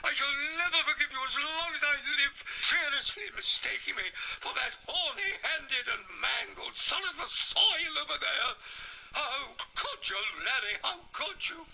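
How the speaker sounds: high, loud wounded outrage; mock-tragic furious shout; outraged shouting. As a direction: bellow the betrayal, half laughing and near tears. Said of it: "he shouted".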